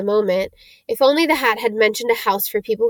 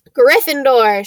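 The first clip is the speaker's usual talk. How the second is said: loud proclamation of the house; shouting hat; 3 semitones higher, 6 dB louder, slower than usual. Acted. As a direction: call the house name out proudly. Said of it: "shouted the hat".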